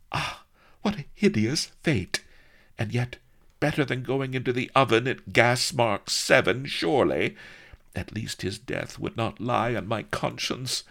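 high, melodramatic lament; tragic princess. A narrator's reading. Princess weighing her lover's fate, high tragic lament.

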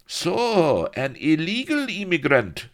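high, loud, contemptuous accusation; sneering Spaniard. A narrator's reading. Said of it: "sneered the Spaniard".

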